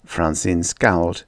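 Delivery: ordinary delivery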